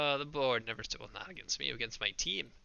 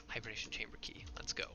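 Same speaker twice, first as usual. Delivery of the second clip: whispering; 3 semitones lower, 7 dB quieter than usual. Real, not acted. Unvoiced read of item.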